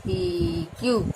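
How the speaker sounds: drawn-out, word-by-word dictation pace; slow dictation. Shown as a demonstration. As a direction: dictate slowly as you write it on the board. Teacher dictates solution while writing. (धीमे-धीमे लिखवाते हुए बोलना)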